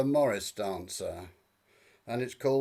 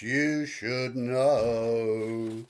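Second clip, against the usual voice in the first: drawn-out ending; 4 semitones lower, much slower than usual. Acted. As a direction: stretch the last words right out. Final line of song, drawn out.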